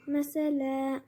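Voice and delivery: slow recited word with long held final vowel; Quran recitation. Shown as a demonstration. Teacher repeating the verse's last word, slow recitation. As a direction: recite it drawn out, then stop.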